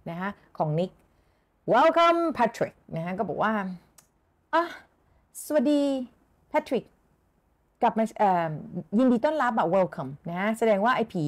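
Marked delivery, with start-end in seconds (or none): 1.7-2.8 stiffly. Shown as a demonstration. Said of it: "said Nick stiffly". 4.0-6.8 stiff, forced. Shown as a demonstration. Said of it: "พูดแบบฝืนๆ".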